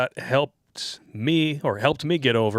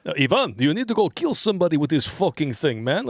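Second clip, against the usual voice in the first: higher-pitched put-on comrade voice; acted soldier banter; 6 semitones higher than usual. Host as a comrade nagging Ivan to use the weapon, put-on voice. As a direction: play an exasperated comrade, pitched up.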